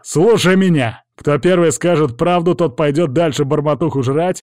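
forceful shout to take control; yelling. A narrator's reading. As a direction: bellow orders at a gang. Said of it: "заорал я".

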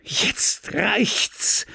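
furious outburst. Acted. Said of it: "schrie sie mit furchtbarer Miene".

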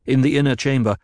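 ordinary delivery, nothing marked

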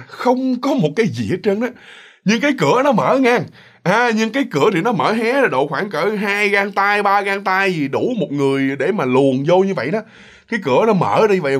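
plain reading voice

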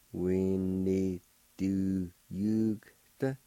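We need to yugta drawn out slowly; slow teaching take of We need to yugta; very slow demo. Shown as a demonstration. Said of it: "like this".

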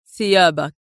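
mildly mocking, firm order; a curt demand with a touch of scorn; sarcastic sternness. A narrator's reading. Adib leans toward captive Goldman, sternly sarcastic. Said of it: "قائلًا في صرامة ساخرة".

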